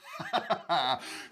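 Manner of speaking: short amused laugh; delighted laugh. Acted. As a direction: laugh with pleasure at the story. Sultan delighted by the tale.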